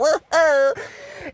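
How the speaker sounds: high mock giggle. Real, not acted. Laugh after mock voice.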